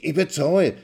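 very high, loud, stuttering rage; husband offering money in anger; heated stammering. Acted. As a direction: sputter it out, furious.

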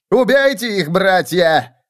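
high, loud villain's order; shouted command. Acted. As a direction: scream the order at his minions. Mutant captain orders the cultists.

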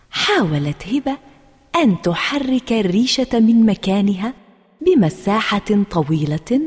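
ordinary delivery

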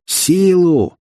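high, drawn-out challenge; outraged veteran taking them on; indignant. A narrator's reading. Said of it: "возмутился какой-то ветеран". (возмущённо, с вызовом)

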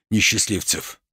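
usual speaking voice

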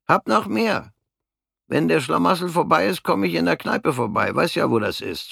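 höhere, schlichte Stimme eines kleinen Mannes; kleiner Mann. Acted. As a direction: als harmloser kleiner Kerl von seinem Schicksal erzählen. Der kleine Mann verabschiedet sich. (der kleine Mann erzählt Fritz von der Front, mit höherer Stimme)

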